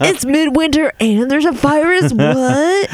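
high-pitched spring breaker disbelief; hammed-up party-kid bafflement; baffled spring breaker. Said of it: "that's your impression of a spring break".

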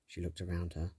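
usual speaking voice

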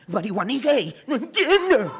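voz chillona mucho más aguda, burlándose; persona confundida. Retold or imitated. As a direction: imita al que colapsa sin entender. (burla aguda de alguien confundido)

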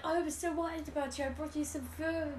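soft attempt at a vaguely Mexican accent; muddled foreign accent. Co-host imitating the man bringing food, in a muddled Mexican accent. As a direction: put on a wobbly Italian-Spanish accent. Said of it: "I don't know what the accent was".

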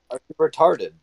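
mock outrage. Real, not acted. Mocking friend in game chat.

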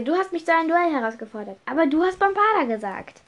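high, fast protest; arguing back. Acted. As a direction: throw the blame back quickly. Accused boy argues back.

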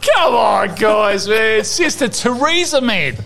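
frustrated loud insistence; loud urging. Real, not acted. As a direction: shout it at them to get the joke. Pushing his punchline.

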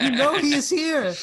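mock scream. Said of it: "Trish running ... screaming".

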